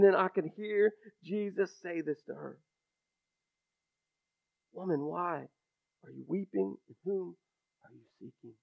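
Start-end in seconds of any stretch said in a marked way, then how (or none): 4.7-6.8 soft and hushed, gentle concern; Jesus gently asking a weeping Mary, softly; compassionate. Acted. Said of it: "must have said that with compassion". 6.9-8.6 soft and hushed, gentle concern; Jesus gently asking a weeping Mary, softly; compassionate. Acted. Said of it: "must have said that with compassion".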